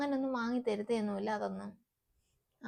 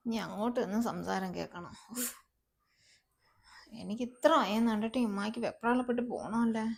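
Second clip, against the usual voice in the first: ordinary talk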